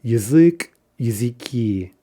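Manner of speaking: "Язык" as ya-ZYK, "языки" as ya-zy-KI; stress on final syllables. Shown as a demonstration. Teacher modelling an end-stressed noun, "Язык", "языки" stressed on the final syllable. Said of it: "fairly predictable in terms of being an in-stressed".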